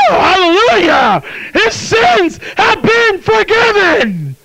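high, drawn-out shouted praise; exultant shout. Real, not acted. Preacher rejoicing to the congregation over forgiven sins, exultant shout. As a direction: shout it out slowly at full pitch.

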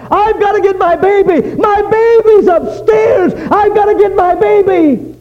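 put-on high frantic scream for her baby; screaming and crying. A narrator's reading. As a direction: shriek it out in panic, voice cracking. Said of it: "began to scream and cry".

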